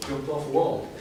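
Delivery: laughing. Real, not acted. Joking aside to the audience.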